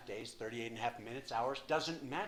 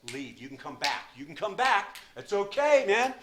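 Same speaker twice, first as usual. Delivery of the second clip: high, loud, wheedling tempter; coaxing inner voice; 8 semitones higher, 12 dB louder than usual. Retold or imitated. The addict's inner voice urging him back to the bar, loud and high. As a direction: sell the drink like a pushy buddy. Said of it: "Voice was like".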